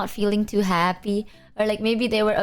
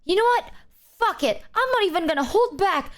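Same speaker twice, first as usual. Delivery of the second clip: higher-pitched mock fed-up rant; mock indignation, pitched up; imitated angry outburst; 9 semitones higher than usual. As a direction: act out a friend working herself up. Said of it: "she gets angry and then she goes".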